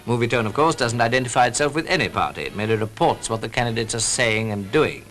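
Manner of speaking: usual speaking voice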